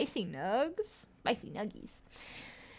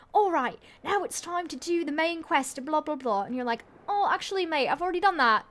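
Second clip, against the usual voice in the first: put-on British accent; 5 semitones higher, 4 dB louder than usual. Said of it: "these British guys make where it's like".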